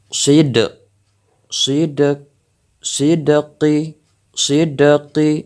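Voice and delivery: "sidqi" broken up with the d bouncing; qalqalah drill on dal. Shown as a demonstration. Teacher drilling the bounced dal piece by piece. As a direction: build up "sidqi", echoing the dal. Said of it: "Harus ada pantulan".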